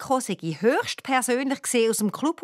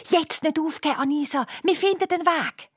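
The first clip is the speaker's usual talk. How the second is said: higher, insistent encouragement; urging a friend; 7 semitones higher than usual. A narrator's reading. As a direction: talk a disheartened friend round. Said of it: "redet auf ihre beste Freundin ein".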